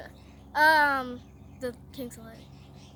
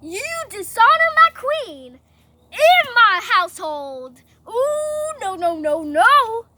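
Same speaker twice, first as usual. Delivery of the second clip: loud, high, hammed-up indignation; outraged scolding; 5 semitones higher, 13 dB louder, slower than usual. King defending his queen's honor, outraged. Furious king confronts Haman.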